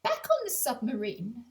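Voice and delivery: ordinary delivery, nothing marked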